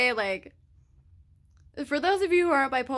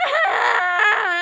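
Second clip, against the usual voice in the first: reenacted wail; 10 semitones higher, 7 dB louder than usual. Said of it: "And I just started crying".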